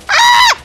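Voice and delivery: sudden high, loud shriek; scream. Real, not acted. Gamer told off for screaming, sudden high scream. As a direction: cut in with a piercing scream. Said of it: "what my neighbors think about me".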